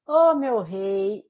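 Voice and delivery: slow, loud, drawn-out mock Bahian; caricatured Bahian drawl. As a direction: stretch it out like a soap-opera Bahian. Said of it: "ainda faz com a entonação".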